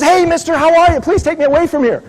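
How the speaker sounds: high, pleading little dog voice, pitched well up; a small dog begging a stranger to take it away; eager talking dog. Acted. Talking dog begs businessman to rescue him, high eager pleading.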